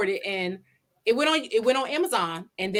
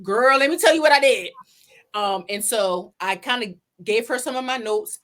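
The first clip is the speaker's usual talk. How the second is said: normal talking voice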